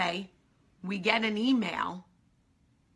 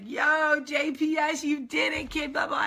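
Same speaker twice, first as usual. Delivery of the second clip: higher, louder, hyped congratulating voice; hyped-up Will; 8 semitones higher, 3 dB louder, slower than usual. Retold or imitated. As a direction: cheer her on like a proud buddy. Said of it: "Will FaceTimed me and was like".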